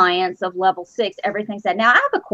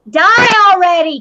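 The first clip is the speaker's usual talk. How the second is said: loud, high-pitched mock exasperation; over-the-top fed-up cry; exasperated mock outburst; 8 semitones higher, 7 dB louder than usual. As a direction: wail it in playful exasperation. Mock rant wishing housemate gone.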